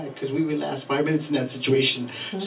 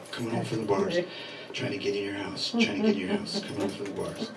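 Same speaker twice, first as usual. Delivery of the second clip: put-on quiet drunk bit; 3 semitones lower, 13 dB quieter than usual. Shown as a demonstration. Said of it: "for this one, I need quiet".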